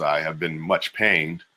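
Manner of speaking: plain reading voice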